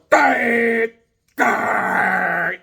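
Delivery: high, loud, muffled silent-screen words; garbled shout into a mute mic; mock muffled mouthing. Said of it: "on the screen going".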